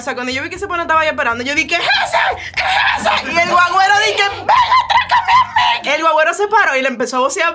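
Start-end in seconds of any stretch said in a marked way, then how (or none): 1.8-3.3 frantic shout. Retold or imitated. Yelling to the bus driver about the robber, frantic shout. 4.3-5.9 very high, slow mocking yell; taunting shout. Daring the robber from across the street, shrill taunting shout.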